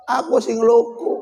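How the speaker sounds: normal talking voice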